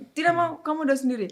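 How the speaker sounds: fast, high-pitched mock refusal; sulky mother. Mother refuses daughter's plea to pray, fast and huffy. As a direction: fire back like a cross mom. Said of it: "udah ngambek banget ya".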